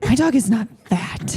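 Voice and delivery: slow, huffy imitation of a miffed pet owner; mock offended owner. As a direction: play the insulted client, drawn out.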